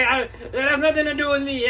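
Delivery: shrill up in outrage at the claim; indignant homeowner. Acted. Homeowner backs off.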